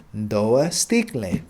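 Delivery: slowly, syllable by syllable, "două sticle"; slow repeat. Shown as a demonstration. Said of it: "So it's gonna be două sticle".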